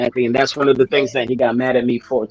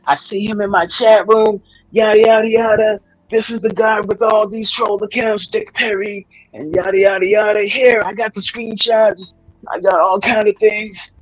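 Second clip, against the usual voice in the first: high-pitched voice imitation; 7 semitones higher than usual. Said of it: "He was like".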